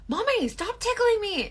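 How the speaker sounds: put-on high, whiny child plea; son's voice. Son begging mom to stop, high whiny voice. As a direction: beg her to stop like a kid.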